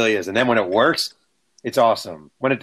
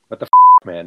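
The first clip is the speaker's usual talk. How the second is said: a sharp, much higher and louder retold cry; outraged yell; 5 semitones higher, 11 dB louder than usual. Player shouting at the writer on his porch, outraged retold yell. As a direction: explode at the intruder mid-word. Angry athlete confronting writer at door.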